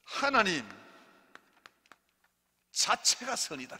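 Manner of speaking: loud, high preaching with long pauses; lead a repeat-after-me. Shown as a demonstration. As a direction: proclaim it phrase by phrase for the congregation. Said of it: "여러분 따라합니다". (따라 하도록 크게 끊어 선포)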